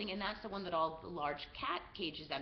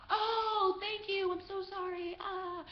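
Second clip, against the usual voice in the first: high, sugary put-on apology; fake grateful tourist; 12 semitones higher, slower than usual. Tourist fooling the guards, mock-grateful apology. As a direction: play helpless and thankful in a high voice.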